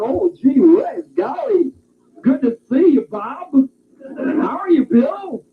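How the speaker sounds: high, stretched, overly cheerful "gee whiz". Acted. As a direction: ham up a prim, eager greeting. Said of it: "You start".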